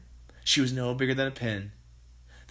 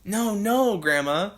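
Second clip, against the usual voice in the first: George crying out; 8 semitones higher, 5 dB louder, slower than usual. A narrator's reading. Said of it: "George cried out".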